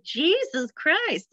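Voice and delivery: high, drawn-out mock dismay; mock unnerved. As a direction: imitate an appalled listener, slow and high-pitched. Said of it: "people could be unnerved by my".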